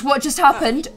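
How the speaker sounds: ordinary talk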